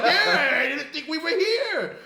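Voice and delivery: high, loud, laughing through the words; laughing reply. Retold or imitated. Retelling the liquor store meeting to his cohost, high and laughing. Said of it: "And I'm like".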